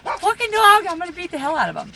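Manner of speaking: high, loud angry yell at the dog. Real, not acted. Owner yelling at a misbehaving dog, angry. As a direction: snap at the dog to cut it out.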